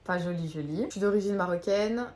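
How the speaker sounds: normal talking voice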